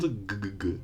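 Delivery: G, G, G as short hard guh sounds; soft repeated voiced G bursts; isolated G sound. Shown as a demonstration. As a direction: sound out a hard g three times, softly. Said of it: "final sound".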